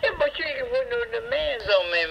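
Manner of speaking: ordinary talk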